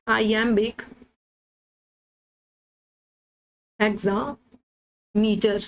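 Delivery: drawn out slowly, word by word; slow dictation. Shown as a demonstration. Teacher dictating the meter name to students, slowly drawn out. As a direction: stretch each word so students can note it down. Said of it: "कौन सा metre use हुआ है?".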